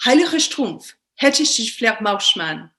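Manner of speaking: néerlandais appliqué, «Heilige» lancé haut, «Vleermuisman» lent; débiter fièrement un néerlandais appris par cœur; faux néerlandais appris. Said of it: "je commence ma présentation". (néerlandais récité fièrement, le début plus haut, la fin lente)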